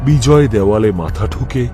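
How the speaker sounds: plain reading voice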